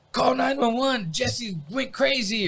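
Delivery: high, slow urgent plea; urgent dad. Retold or imitated. Bleeding dad telling the family to call for help, urgent. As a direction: plead it with alarm. Dad's urgent order quoted.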